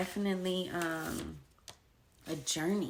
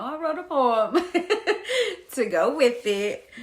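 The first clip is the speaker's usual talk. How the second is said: louder, laughing through it; 5 semitones higher, 11 dB louder than usual. Real, not acted. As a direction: giggle while telling. Laughing while speaking.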